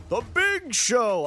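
a muted version of his big show intro; catchphrase shout, held back. Shown as a demonstration. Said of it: "I can't be saying".

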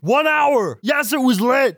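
high, strained all caps voice; all caps panic voice. Acted. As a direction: shout every line in all caps. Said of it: "my all caps voice".

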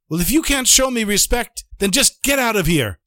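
high, indignant brush-off; acted scolding dismissal; angry dismissal. Rebbe throws the chassid out.

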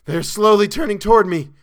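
high, loud panicked cry; terrified shriek. A narrator's reading. Chalmers seeing the creatures turn toward him, shrieking in terror. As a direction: shriek in dread of what is coming. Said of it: "he shrieked".

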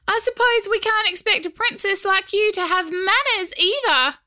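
high, small teasing goblin; tiny gravelly voice. Acted. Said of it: "he said in his gravelly little voice".

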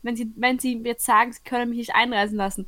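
normal talking voice

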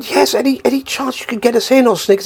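high, hopeful voice; imitated pleading couple. Couple asking Lol to sneak them into the gig, hopeful pleading.

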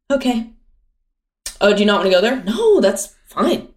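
sweetly passive-aggressive. Retold or imitated. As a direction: act fine while clearly annoyed. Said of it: "my worst trait is probably passive aggression".

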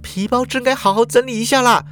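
put-on high girl's voice, exasperated; high, annoyed aside; inner thought. A narrator's reading. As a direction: mutter to herself. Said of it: "他心里想啊".

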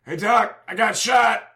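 high, put-on calling voice; wounded complaint. Wounded rider to the doctor, high complaint. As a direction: call out high to get attention.

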